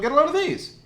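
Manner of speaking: excited showing off. Real, not acted. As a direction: present it like a prize. Showing off pecans to camera.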